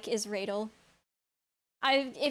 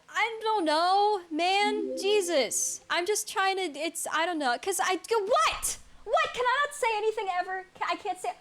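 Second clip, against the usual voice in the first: high, exasperated protest; flustered and defensive; 7 semitones higher than usual. Real, not acted. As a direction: protest like you're cornered. Flustered at being called nerd.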